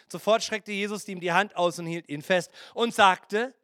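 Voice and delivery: normal talking voice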